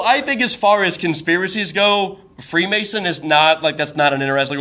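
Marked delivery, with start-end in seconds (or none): none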